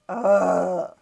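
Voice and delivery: a loud, fed-up groan of ugh; weary ugh. Real, not acted. Mourner groans at yet another death, loudly fed up. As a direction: let out a tired, loud ugh.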